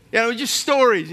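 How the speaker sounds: ordinary talk, nothing marked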